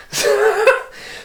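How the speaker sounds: bursts into a high giggle; can't hold back a squeaky laugh; real high laugh. Real, not acted. Reading to her child, breaking into a high laugh.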